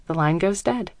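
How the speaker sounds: plain reading voice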